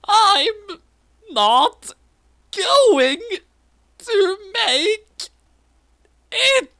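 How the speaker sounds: put-on very high squeaky girl voice, slow, broken words; squeaked. A narrator's reading. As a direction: squeak each word out high and panicky. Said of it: "Misa squeaked".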